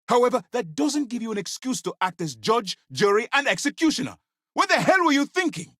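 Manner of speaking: high, heated reprimand building to a shout; outraged commander, voice climbing; furious dressing-down. Acted. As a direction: tear into a reckless subordinate.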